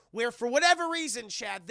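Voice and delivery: ordinary talk, nothing marked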